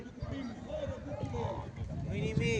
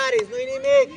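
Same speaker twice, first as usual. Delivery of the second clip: high, loud reassurance; raised, high-pitched sideline encouragement; encouraging shout; 14 semitones higher, 6 dB louder than usual. Real, not acted. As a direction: call comfort across the pitch. Calling encouragement to player.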